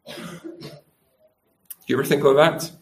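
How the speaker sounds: ordinary delivery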